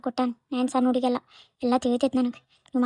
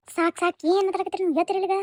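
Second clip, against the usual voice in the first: higher, pleading call to stop the fight; enough-already raised voice; exasperated raised voice; 6 semitones higher than usual. Acted. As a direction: cut them off sharply, pitch up. Mother stops daughters' quarrel.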